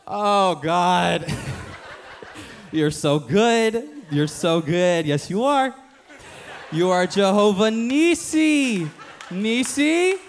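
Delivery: high, loud, slow, gushing mock prayer; over-the-top prayer. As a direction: ham it up like a panicked prayer warrior. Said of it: "you just start talking crazy".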